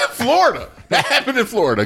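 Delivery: high, giggly laughing talk. Real, not acted. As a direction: say it through laughter. Laughing at Florida joke.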